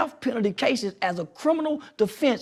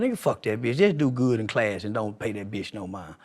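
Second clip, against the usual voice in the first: street talk demo; 10 semitones lower, 4 dB quieter than usual. Shown as a demonstration.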